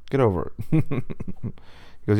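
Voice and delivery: plain reading voice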